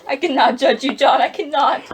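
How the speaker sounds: anguished cry. Acted. Wife refusing to judge her husband, anguished loud cry. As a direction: cry it out, near breaking.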